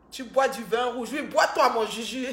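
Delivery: loud and high, laughing at the end; loud tease about the red wine; loud teasing. Real, not acted. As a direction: rib Juju loudly.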